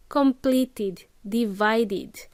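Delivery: "Completed, divided" with a clear "id" syllable; added id ending. Shown as a demonstration. Teacher demonstrating the rule, id added at the end. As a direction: add "id" after "complete" and "divide". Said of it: "must add that id".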